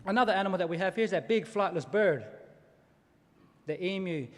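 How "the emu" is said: normal talking voice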